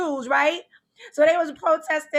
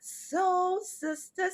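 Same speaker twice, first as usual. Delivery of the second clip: slow show title; 4 semitones higher, 6 dB quieter, much slower than usual. Acted. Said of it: "I just made that up myself".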